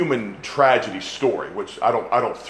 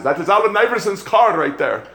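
higher-pitched mock Pakistani accent; imitated Pakistani accent; 8 semitones higher, 3 dB louder than usual. Preacher imitating a bystander spotting a star's car, Pakistani accent. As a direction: put on an excited Pakistani accent. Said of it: "he was actually Pakistani, honey. That's my, that's not my Honduran accent".

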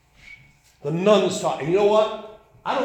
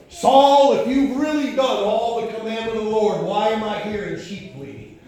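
preaching scripture; 4 semitones higher, 7 dB louder than usual. Acted. Preacher voicing Samuel confronting Saul, loud preaching. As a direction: proclaim the verse to the whole congregation.

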